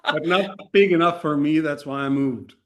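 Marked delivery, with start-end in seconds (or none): none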